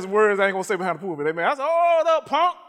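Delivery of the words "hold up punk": high, loud challenge to the devil; retold rebuke. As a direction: call out a drawn-out, defiant halt. Repeats telling off the devil.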